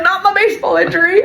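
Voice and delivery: high, put-on whine of mock despair; mock lament. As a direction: wail it like a silly tragedy. Joking about old injury.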